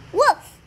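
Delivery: loud playful woof; excited dog woof; dog bark. Acted. Child reads dog barking.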